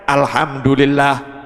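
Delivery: unbothered, low and drawn-out reply; Mbah Umar. Acted. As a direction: answer a weeper with slow, relaxed calm. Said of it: "Mbah Umar santai jawabannya". (santai, pelan dan tenang)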